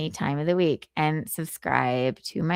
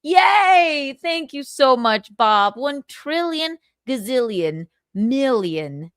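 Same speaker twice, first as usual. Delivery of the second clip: gushing excited voice; 5 semitones higher, 4 dB louder, much slower than usual. Acted. Gushing thanks in character.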